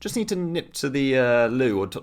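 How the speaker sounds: high, lightly voiced polite excuse. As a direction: make a chirpy exit excuse.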